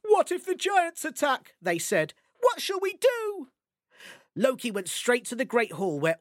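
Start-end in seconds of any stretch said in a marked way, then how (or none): 0.0-1.5 high, anxious cry; higher worried question; worried gods. Acted. 2.4-3.5 high, anxious cry; higher worried question; worried gods. Acted.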